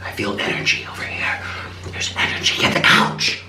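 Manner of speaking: hushed, half-whispered mock mystic; mystical healer impression. Storyteller mimicking the cuckoo retreat owner with her crystal stick, hushed mock-mystical. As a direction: imitate a healer dramatically sensing energy. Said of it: "wave the stick around and be like".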